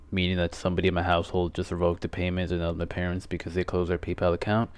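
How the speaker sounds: ordinary talk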